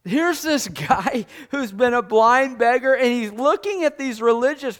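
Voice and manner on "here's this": normal talking voice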